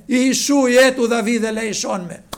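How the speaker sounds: loud, pleading cry. Acted. Blind beggar crying out to Jesus again, loud plea. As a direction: cry for mercy with everything. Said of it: "μάλλον έκραζε".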